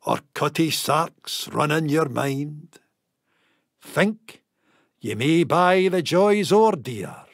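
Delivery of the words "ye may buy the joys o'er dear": plain reading voice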